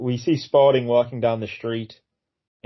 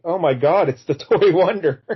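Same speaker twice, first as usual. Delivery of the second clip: higher-pitched comic gasp of recognition; mock amazement; 6 semitones higher, 4 dB louder than usual. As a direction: act out being stunned, half laughing. Acting out a startled guard.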